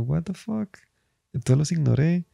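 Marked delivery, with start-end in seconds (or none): 0.0-0.8 high, hushed disbelief; a startled, breathy what-the-fuck pitched way up; baffled. Retold or imitated. Comedian baffled as hecklers stand up to fight, high hushed. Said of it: "Y yo".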